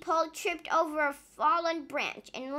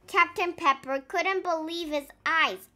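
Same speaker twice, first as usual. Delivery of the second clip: ordinary delivery, nothing marked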